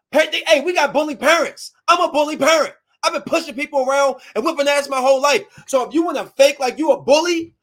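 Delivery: loud, high, heated rant; worked-up, confrontational shouting; angry tirade. Retold or imitated. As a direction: lay into them as a bully parent. Retold tirade at bullying kids.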